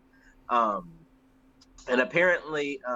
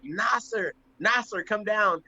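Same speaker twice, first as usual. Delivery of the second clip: retold calling out; 7 semitones higher, slower than usual. Airman calls for the African controller at gunpoint, retold mild yell. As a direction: imitate calling someone down, pitched up. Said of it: "I'm yelling for the".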